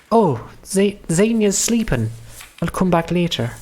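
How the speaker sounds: high, put-on Welsh voice; Welsh accent. Lena finding Xenia asleep, high Welsh accent. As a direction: play her Welsh, pitched well up. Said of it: "Oh, she was Welsh".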